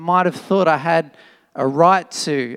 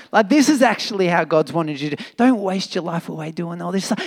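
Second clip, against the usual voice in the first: ordinary talk, nothing marked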